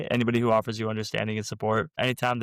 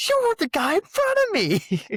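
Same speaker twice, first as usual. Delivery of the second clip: very high, loud comic squeal; hammed-up high-pitched retort; squealed punchline; 6 dB louder than usual. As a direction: squeal it out, stretching the last word. Acts out punchline of retold exchange.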